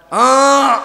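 put-on high, loud whining child's cry; a child's demanding wail; whiny child cry. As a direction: wail like a small child tugging at his father. Child tugging father at shop.

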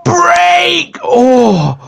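high, drawn-out panicked cry; dramatic wail. Real, not acted. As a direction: cry out high and long, then groan. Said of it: "You sound like Nick Ricardo Avocado".

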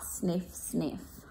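soft, breathy sniff sniff; sniffing dog. Acted. The sniffy dog following the scent, sniffing.

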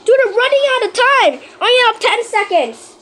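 high, loud panicked counting; frantic countdown. Real, not acted. Gamer shouting out the final seconds, high and loud. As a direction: race the clock out loud.

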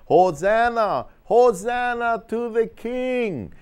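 high, slow, loud crowd cry of praise; crowd cheering. Acted. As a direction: call it out like a jubilant crowd. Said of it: "They're all yelling".

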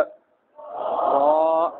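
रा with a rolled Arabic r, sung out; Arabic letter name. Shown as a demonstration. Teacher demonstrating रा to Urdu-speaking pupils. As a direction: say रा the Arabic way, drawn out. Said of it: "अरबी में इनका तलफ़ुज़ क्या है".

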